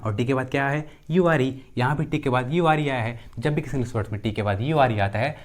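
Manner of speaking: normal talking voice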